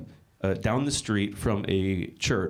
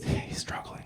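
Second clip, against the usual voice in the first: hushed unvoiced aside; mock whisper to the person next to you; 8 dB quieter than usual. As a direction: whisper it to your neighbour. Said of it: "quietly whisper to the person next to you".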